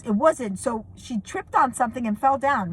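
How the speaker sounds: ordinary delivery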